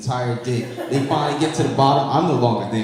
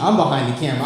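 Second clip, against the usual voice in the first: ordinary talk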